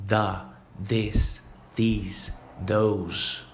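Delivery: The, this, these, those each said with the tongue between the teeth; slow careful th on The, this, these, those; th demo word by word. Shown as a demonstration. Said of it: "O som adequado é sempre colocando a língua entre os dentes".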